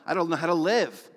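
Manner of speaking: a pleading shout in a jail cell; crying out to God. Retold or imitated. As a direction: cry out to God from the bottom. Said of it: "I was crying out saying".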